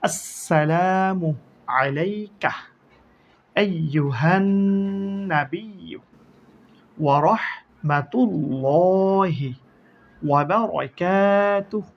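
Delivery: আসসালামু and রাহমাতুল্লাহি drawn out slowly; slow recitation. Shown as a demonstration. Teacher reciting the greeting line for students to learn, slow. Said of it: "এখান থেকে আমরা উচ্চারণ পড়া শিখি".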